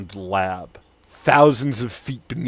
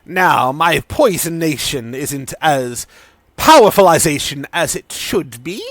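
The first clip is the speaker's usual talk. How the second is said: mad scientist Sidon; 5 semitones higher, 7 dB louder than usual. Acted. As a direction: warn with gleeful menace.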